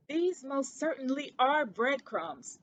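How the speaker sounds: slow, emphatic insistence; Ced insisting to his cousin, slow and emphatic; said emphatically. Acted. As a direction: lean on every word to prove the point. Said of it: "he said emphatically".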